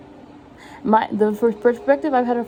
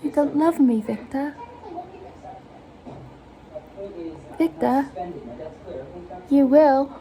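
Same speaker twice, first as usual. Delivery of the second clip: higher voice, pleading with Victor; Corpse Bride; 4 semitones higher, slower than usual. Acted. Imitating the bride talking to Victor, higher-pitched role voice. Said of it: "Is how she even sounded".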